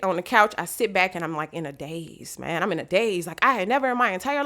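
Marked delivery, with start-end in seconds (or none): none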